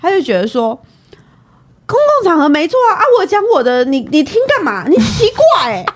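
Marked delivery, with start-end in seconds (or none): 1.8-5.8 high, louder, fast defiant comeback; imitating a furious friend; angry retort. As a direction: fire it back at her. Friend snapping back angrily.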